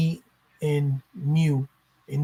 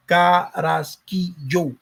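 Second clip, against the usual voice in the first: Carrasquillo very slowly, Ca-rras-qui-llo; separated syllables; much slower than usual. Shown as a demonstration. Showing people how to take the syllables, Carrasquillo syllable by syllable. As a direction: break Carrasquillo into four syllables with gaps between. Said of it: "separate them".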